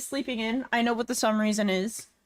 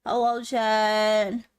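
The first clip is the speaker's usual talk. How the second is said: slow stretched greeting; drawn-out hello; much slower than usual. Real, not acted. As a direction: draw out the hello slowly.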